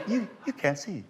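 high, soft mock doubt; arguing with herself. Actress watching the show, softly arguing with herself. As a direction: imitate puzzled talking to oneself, softly. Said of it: "she's sitting there arguing with herself".

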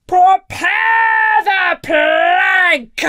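very slow, high, full-force pirate roar; roaring captain. A narrator's reading. Furious captain orders the plank, roaring. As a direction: bellow it, stretching every word. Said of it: "he roared suddenly".

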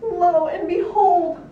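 high, loud, slow proclamation; slow piggy squeal; squealed exclamation. A narrator's reading. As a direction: squeal it out, drawing each word long. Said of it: "squealed two".

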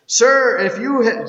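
high, loud urgent cry; urgent plea. Acted. Mary begs the man she takes for the gardener, loud urgent plea. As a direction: burst out begging.

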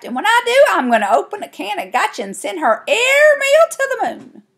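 high, loud, worked-up threat; snapped threat. A narrator's reading. Girl vowing revenge on her sister, snapping. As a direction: holler the threat high, stretching the big words. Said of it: "I snapped".

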